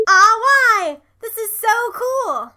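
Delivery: put-on high, loud kid's protest; clipping-loud whine. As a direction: whine it at full volume. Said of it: "Am I clipping?".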